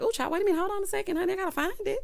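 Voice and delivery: high-pitched, fussing protest; flustered plea. Retold or imitated. Driver rushed for a license, high flustered protest. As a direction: stall them in a high, flustered voice.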